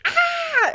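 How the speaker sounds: high, loud squeal of cuteness overload; grabbing the cat, a high loud ah; cute aggression squeal. Retold or imitated. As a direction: burst out with a squeezed ah. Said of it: "grab his arm and be like".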